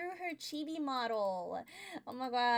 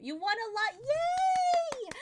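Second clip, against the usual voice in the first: excited cheer; 13 semitones higher, 7 dB louder, much slower than usual. Real, not acted. Cheering raider's wins.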